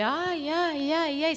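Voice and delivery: agudo, imitando un lamento exagerado; mock lament. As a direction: ayes de quien se queja sin sentirlo de verdad. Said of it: "se lamentan". (lamento fingido, agudo y teatral)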